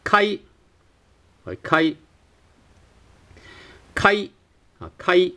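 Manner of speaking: kai with a short a into i; Cantonese kai. Shown as a demonstration. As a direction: say kai with a clipped ai. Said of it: "in this case we have".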